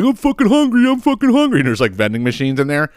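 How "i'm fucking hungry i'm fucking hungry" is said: high, whining voice, much higher than usual; whiny hungry guy. Retold or imitated. Mocking a guy stuck at the impound lot, high and whiny. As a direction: complain like a starving man at the impound. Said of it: "the guy was like".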